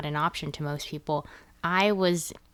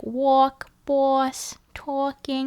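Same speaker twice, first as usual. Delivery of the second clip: New York aw sound; 8 semitones higher, 4 dB louder, much slower than usual. Shown as a demonstration. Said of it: "if it has an aw sound".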